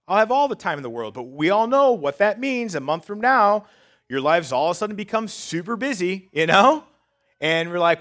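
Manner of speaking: high, loud, driving sermon voice; full-force preaching. Acted. Preacher rallying insecure listeners, loud and high. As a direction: preach it out to the congregation.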